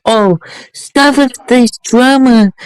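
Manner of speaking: mocking her. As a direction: mimic her dismissive brush-off, drawn out. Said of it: "she's like".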